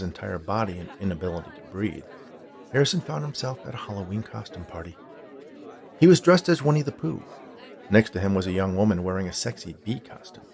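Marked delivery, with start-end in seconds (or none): none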